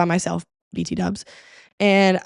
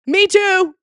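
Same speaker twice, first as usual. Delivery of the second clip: sudden high, loud squeal; a thrilled high-pitched yelp; excited; 11 semitones higher, 6 dB louder than usual. Pretend. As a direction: blurt it out like a giddy fan. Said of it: "Hey, guess what?".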